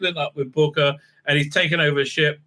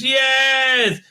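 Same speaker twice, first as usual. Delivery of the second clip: high, punchy cries of pleasure; hammed-up ecstatic exclamations; mock aroused cries; 9 semitones higher, 3 dB louder than usual. Vulcan woman aroused by the mind meld, in mock high cries. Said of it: "quite aroused".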